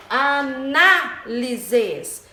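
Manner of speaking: "análises" very slow, big stretched NA; syllable by syllable "análises", strong second syllable; stressed syllable. Shown as a demonstration. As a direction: hit the NA of "análises". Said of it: "O na é a antepenúltima".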